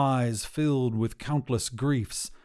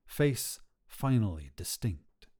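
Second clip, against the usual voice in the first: ordinary delivery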